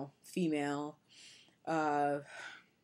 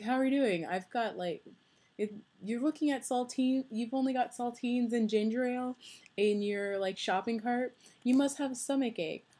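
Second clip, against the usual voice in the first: lightly voiced friendly stranger, pitched higher; imagined friendly stranger; 6 semitones higher than usual. Dream guy spotting saltines in her shopping cart, lightly acted in higher pitch. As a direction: play a concerned passer-by, a little higher than usual. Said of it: "would be a guy who would come".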